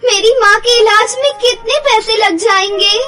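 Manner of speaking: high, loud, crying disbelief; tearful shock. Acted. Anjali asks the doctor about costs, crying and loud. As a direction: stammer through tears in shock. Daughter hears mother in coma.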